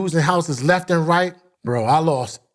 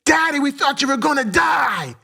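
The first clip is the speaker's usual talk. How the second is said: high scream; daughter screaming; 11 semitones higher than usual. Retold or imitated. Daughter to her father after his seizure, high scream. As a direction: cry it out as a scared child. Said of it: "my daughter screams".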